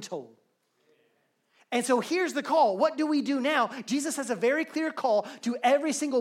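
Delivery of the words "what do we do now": ordinary talk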